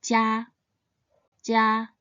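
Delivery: "jia" held high and level; Mandarin first tone. Shown as a demonstration. Teacher models the word for home, "jia" high and level. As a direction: sustain "jia" on a steady high pitch, first tone. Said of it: "it should be pronounced in the first tone".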